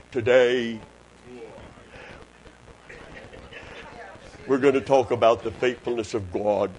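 mock preacher dropping very low; put-on preacher voice. Preacher mimicking others behind the pulpit, low put-on voice. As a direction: imitate a solemn, low pulpit voice. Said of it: "change their voice an octave".

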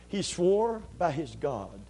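ordinary talk

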